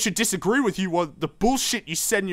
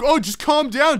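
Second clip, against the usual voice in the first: mock calming; 6 semitones higher than usual. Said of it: "And then you're like".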